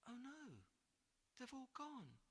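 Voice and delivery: put-on a boy's heart sinking; dismayed. George to the giant, soft dismay. George finds backpack empty.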